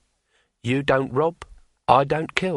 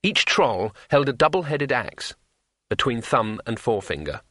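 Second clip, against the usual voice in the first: usual speaking voice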